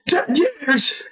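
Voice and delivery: high squeak of shock; shocked disbelief. Retold or imitated. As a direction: gasp it out in disbelief. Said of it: "It's like".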